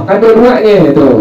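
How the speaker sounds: ordinary delivery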